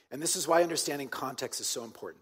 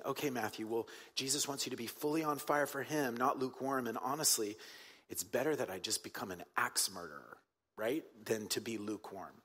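quiet inner thought; 3 semitones lower, 7 dB quieter than usual. Retold or imitated. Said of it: "I remember thinking".

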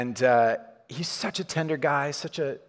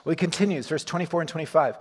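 ordinary talk

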